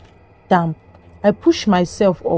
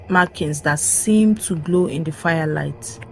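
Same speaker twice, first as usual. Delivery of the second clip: plain reading voice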